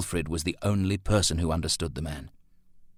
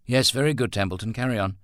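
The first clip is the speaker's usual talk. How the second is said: higher, brisk boss; brisk dismissal; 6 semitones higher, 4 dB louder, faster than usual. A narrator's reading. Employer urges his worker to continue, brisk. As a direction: cut in with a raised, businesslike voice.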